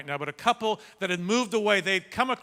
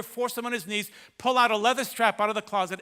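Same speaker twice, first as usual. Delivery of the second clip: usual speaking voice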